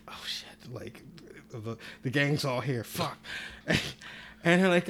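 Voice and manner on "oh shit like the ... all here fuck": whispered, panicked under his breath; scared whisper from inside the closet; hushed panic. Retold or imitated. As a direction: keep it hushed so nobody hears. Hiding naked in closet.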